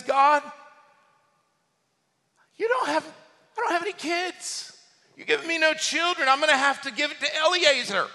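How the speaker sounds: high-pitched whining protest to God; acted complaint. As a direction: grumble upward, voice pitched high. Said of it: "He wasn't shouting and running around saying".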